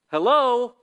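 loud, lifted hello; incredulous hello. Retold or imitated. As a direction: call out half in disbelief. Surprised call to God.

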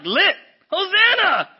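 high, loud, slow hype shout; hyped crowd. Retold or imitated. Crowd hails Jesus as conqueror, loud and hyped. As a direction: shout it like the party's starting. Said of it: "they're excited".

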